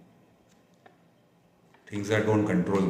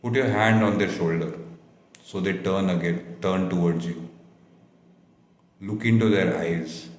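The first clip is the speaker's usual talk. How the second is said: guided meditation. Acted. Leads a guided visualization.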